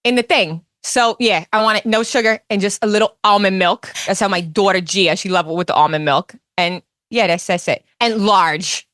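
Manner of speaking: Teresa Giudice impression. Teresa ordering iced coffee at Dunkin', Jersey accent. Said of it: "Teresa Giudice ordering a cup of coffee".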